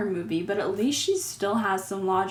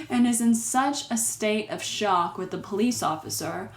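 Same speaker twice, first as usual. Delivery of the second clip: usual speaking voice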